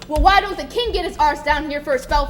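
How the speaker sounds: loud bitter tirade; angry rant. Acted. Jenkins to Billy, loud bitter rant. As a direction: rail against the king loudly.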